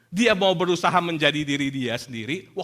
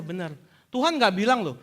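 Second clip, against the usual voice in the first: ordinary talk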